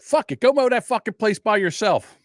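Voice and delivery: high-pitched fed-up dismissal; retold angry refusal. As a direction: act out quitting on the cousin. Acted quarrel, storming off from cousin.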